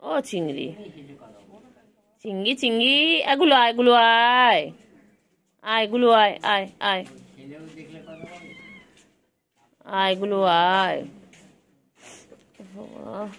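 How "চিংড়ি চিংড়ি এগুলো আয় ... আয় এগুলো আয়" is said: loud drawn-out call to the pets; loud sing-song call for the animals. Real, not acted. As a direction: call them in across the yard, loud and long. Calling pets to come.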